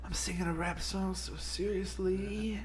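high, slow, airy mock-serious voice; over-exaggerated breathy singer. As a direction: ham up a breathy earnest crooner. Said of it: "stupid breathy voice ... real over-exaggerated voice".

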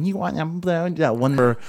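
put-on a faint, soft stab at a creepy child voice; half-copy the friend's gross kid voice; imitating a child voice. Recalling his friend's gross child voice in a faint, soft imitation. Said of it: "that terrible, like, child voice thingy".

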